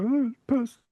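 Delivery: high, put-on wail of complaint; whimpering pillow victim. A punched pillow complaining at counselling, high mock whine. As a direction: plead your case in a squeaky voice.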